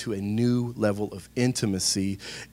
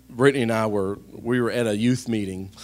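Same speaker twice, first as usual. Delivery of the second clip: ordinary talk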